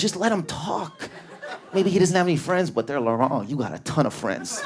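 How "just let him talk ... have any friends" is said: sympathetic co-worker. Acted. Bakery worker defends Serge to a colleague, high-voiced. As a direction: imitate a worker pleading his case, pitched up. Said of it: "And the other worker goes".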